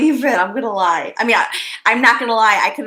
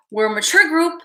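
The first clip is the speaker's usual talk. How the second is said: normal talking voice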